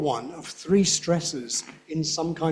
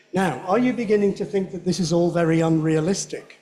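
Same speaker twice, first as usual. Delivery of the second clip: usual speaking voice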